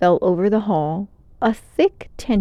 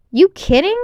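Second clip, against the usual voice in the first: casual; 10 semitones higher, 7 dB louder than usual. A narrator's reading. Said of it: "he said casually".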